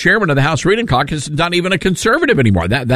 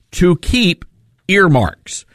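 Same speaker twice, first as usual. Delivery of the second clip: ordinary talk, nothing marked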